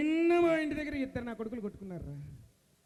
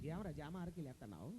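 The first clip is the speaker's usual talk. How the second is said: soft, low, suspicious grumble; low muttered query; 6 semitones lower, 12 dB quieter than usual. Acted. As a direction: ask it under your breath. Host queries the star's odd gesture.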